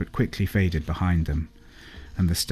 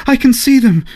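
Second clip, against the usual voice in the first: high, loud terrified cry; gasped in fright; 12 dB louder than usual. A narrator's reading. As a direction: cry out in panic at the window. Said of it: "she gasped".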